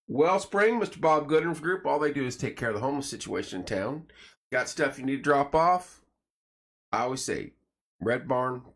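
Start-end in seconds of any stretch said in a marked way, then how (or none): 0.1-6.0 upbeat sponsor plug, punchy and bright; radio ad read. Acted. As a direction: sell it like a local radio spot. Reads sponsor plugs.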